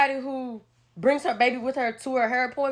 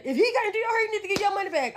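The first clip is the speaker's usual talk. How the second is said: high-pitched mock yelling; 8 semitones higher, faster than usual. Imitating the mom's friend on the phone, high mock yell. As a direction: squeal it like the indignant caller. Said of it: "on the phone yelling".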